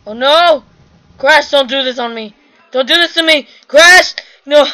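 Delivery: loud, rising yells of dismay; loud panic as his partner disconnects; panicked shout. Real, not acted. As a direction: shout desperately after a teammate who is leaving. Teammate leaving him alone in-game.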